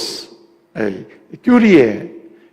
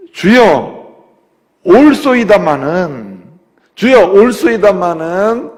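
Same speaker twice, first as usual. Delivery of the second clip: slow, louder pleading Lord; drawn-out plea; 5 semitones higher, 8 dB louder, much slower than usual. Acted. Pastor voicing the woman's humble reply, slow and pleading. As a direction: stretch each word like a humble plea.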